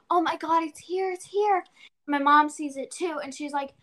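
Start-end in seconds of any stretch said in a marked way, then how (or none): 0.0-1.6 higher, thrilled relief; excited. Retold or imitated. Girl finding the turtle sunbathing, excited. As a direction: blurt it out overjoyed. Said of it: "I was like, gasps".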